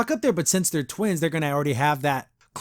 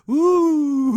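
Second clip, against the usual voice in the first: mock ooh; 10 semitones higher, 5 dB louder than usual. Real, not acted. Mocking the idea of character growth.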